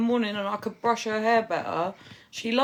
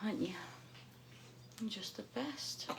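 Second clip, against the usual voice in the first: tender hushed whisper; 12 dB quieter than usual. Real, not acted. Whispering to sleeping baby.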